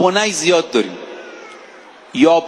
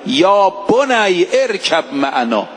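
slow verse recitation; slower than usual. Shown as a demonstration. Repeats the verse to stress it.

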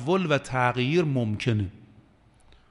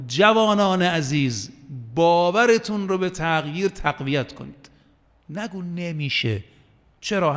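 ordinary delivery

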